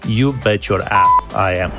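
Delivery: normal talking voice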